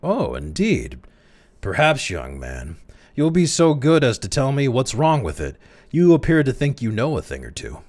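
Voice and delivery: mock him politely; ironic. A narrator's reading. The chairman challenges the clerk with smiling irony. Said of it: "a smile in which there was a lot of irony".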